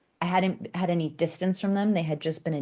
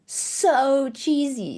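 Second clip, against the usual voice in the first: "so cheesy" slowly, higher and louder, dripping with scorn; mocking drawl; 9 semitones higher, 3 dB louder, much slower than usual. Shown as a demonstration. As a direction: stretch "so cheesy" out in disgust. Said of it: "sounded so cheesy".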